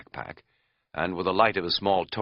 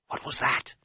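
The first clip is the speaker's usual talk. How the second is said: hushed, higher-pitched question; anxious whisper; 7 semitones higher than usual. Acted. Camper to tentmate about a noise, whispered. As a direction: whisper it, on edge.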